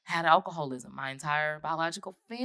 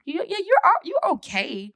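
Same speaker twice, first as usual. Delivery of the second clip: higher, louder mock-reassuring yeah yeah; mock soothing therapist; 6 dB louder than usual. Therapist gently humoring the client, mock reassuring. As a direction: humor her like a patient therapist. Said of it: "She's like".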